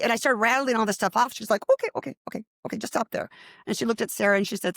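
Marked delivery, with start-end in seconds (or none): 1.7-3.3 high-pitched rush of okays, then settling; overwhelmed interruption. Retold or imitated. Teacher halting the student's rattled-off reading, overwhelmed. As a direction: imitate a teacher cutting someone off.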